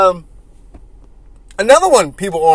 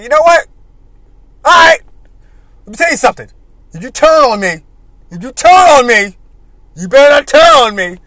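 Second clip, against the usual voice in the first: raised warning; 4 semitones higher, 7 dB louder than usual. Retold or imitated. Sting voiced warning Flair.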